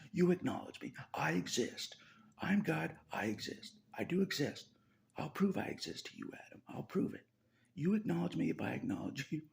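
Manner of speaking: imitating God demanding. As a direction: play God insisting, half-joking.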